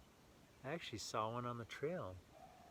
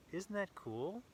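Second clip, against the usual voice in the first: ordinary delivery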